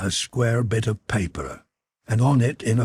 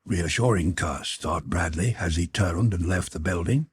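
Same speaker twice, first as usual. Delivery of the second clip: ordinary delivery, nothing marked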